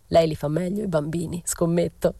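ordinary delivery, nothing marked

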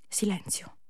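whispered narration; soft whispered storytelling. A narrator's reading. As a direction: hush the words almost to nothing.

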